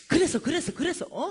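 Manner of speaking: high, loud prompting; eager listener. Retold or imitated. Preacher urging a homeless woman to keep talking, eagerly. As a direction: egg the storyteller on eagerly. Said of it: "하고 말을 해주니까 재밌으니까".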